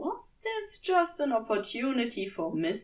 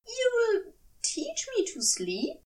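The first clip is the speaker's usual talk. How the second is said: higher hopeful question; pitched-up eager angel; drunk Aziraphale voice; 10 semitones higher than usual. Acted.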